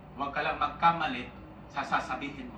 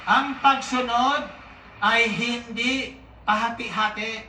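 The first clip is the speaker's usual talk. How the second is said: slow, loud, deliberate; preacher pounding the moral, slow and loud; emphatic slow declaration; 4 semitones higher, 6 dB louder, slower than usual. Real, not acted. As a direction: drive each word home to the congregation. Preacher hammering the lesson.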